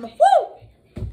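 high, loud woo; worship shout. Real, not acted. Preacher to church, loud joyful woo. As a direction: cry out in praise.

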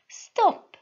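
sharp high shout to halt him. A narrator's reading. Noblewoman on horseback shouting at the attacker. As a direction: yell stop at the man. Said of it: "she shouted it".

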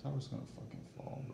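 fast hushed whisper. Real, not acted. Tense moment, tower about to fall.

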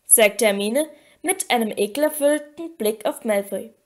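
plain reading voice

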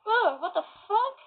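a startled whoa, acting out Kate; shocked Kate. Retelling Kate's reaction to the kiss, mock shock. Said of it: "she's like".

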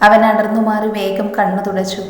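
usual speaking voice

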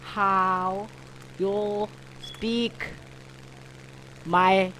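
word by word, long pauses; mock slow speech. Gamer asking how you speak chicken, mock slow speech. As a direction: spell it out one halting word at a time.

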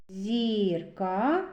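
зірка with the i held long; stretched vowel i. Shown as a demonstration. Teacher picking out a vowel, long i in зірка. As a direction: draw out the i in зірка slowly. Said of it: "і голосний".

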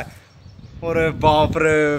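long drawn-out oh my god, stretched slow; shocked gasp. Retold or imitated. Telling a friend about spotting the police siren, drawn-out shock. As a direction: let the dread stretch out each word.

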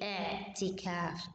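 اعتكاف with a clear ain, slow and careful; correct ain pronunciation. Shown as a demonstration. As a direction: model the right اعتكاف with the ain. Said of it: "no, say". (نطق صحيح بالعين ببطء)

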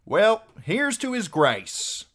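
high, loud, slow toast; cheerful call. A narrator's reading. Archer lifting a toast, cheerful high call. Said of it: "called out cheerfully".

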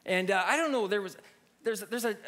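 ordinary delivery

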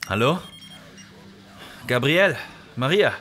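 uneasy calls into a silent intercom; worried calling. Acted. Sergei calling the lab, getting no answer, worried.

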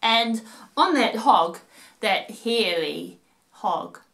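put-on lively sing-song reading to children; storytelling voice. A narrator's reading. Narrator reading a rhyme to kids at home, playful storytelling voice. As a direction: read it like a bedtime rhyme for little ones.

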